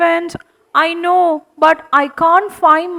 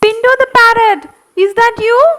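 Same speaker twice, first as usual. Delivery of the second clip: excited call; 6 semitones higher, 3 dB louder, faster than usual. Acted. Charlie calls to the parrot.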